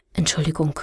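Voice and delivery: soft mumbled apology; murmuring. A narrator's reading. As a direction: mumble it under your breath. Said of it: "murmelte Luan". (leise gemurmelt)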